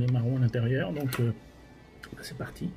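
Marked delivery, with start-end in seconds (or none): 2.1-2.7 whispering. Real, not acted. Quiet aside before tasting.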